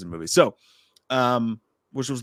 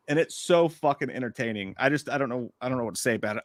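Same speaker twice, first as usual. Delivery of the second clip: ordinary talk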